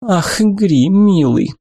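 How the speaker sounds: high, tender and affectionate. A narrator's reading. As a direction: coo it lovingly. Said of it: "голос звучал ласково". (ласково)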